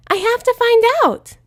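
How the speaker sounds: very high, loud eager squeal; can't wait a second longer; high loud thrilled pony squeal; excited Pinkie. Acted.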